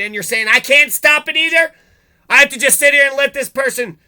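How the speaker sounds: yelling in real indignation; furious shout. Real, not acted. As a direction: let the rage break loose at the cops. Ranting at police, boiling over.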